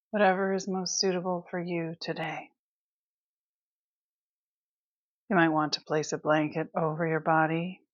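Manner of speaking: calm, unhurried Yoga Nidra guidance; guided meditation. Acted. Teacher settles listeners into Yoga Nidra, calm guided meditation. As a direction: guide them into rest, leaving long pauses between phrases.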